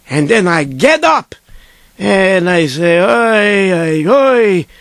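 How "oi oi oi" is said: long drawn-out oys; weary groaning. Retold or imitated. Sleepy dreamer getting up at rabbi's order, weary groaning. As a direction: complain reluctantly on stretched syllables.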